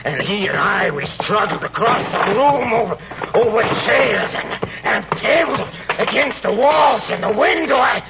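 high-pitched, agitated retelling with breaks; frantic recollection. Acted. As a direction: relive the struggle, mounting toward panic. Reliving a violent struggle.

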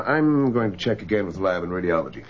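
ordinary talk, nothing marked

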